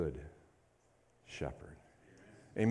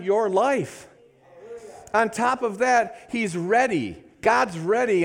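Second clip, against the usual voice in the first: raised, louder preacher's voice, building fervour; full-force preaching; 9 semitones higher, 6 dB louder than usual. Acted. Pastor stirring up the church, preaching at full force. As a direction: preach it out to the congregation with rising fire.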